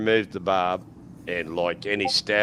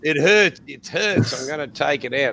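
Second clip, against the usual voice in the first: higher, louder complaining of pain; mock whining pain; 8 semitones higher, 7 dB louder than usual. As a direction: play the hurt drunk, loud and high. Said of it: "And he's going".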